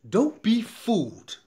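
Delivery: slow, loud and high; stern warning. Real, not acted. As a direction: lean on every word like a warning. Warning viewers about overpriced tax services.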